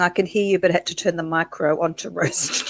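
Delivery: ordinary talk, nothing marked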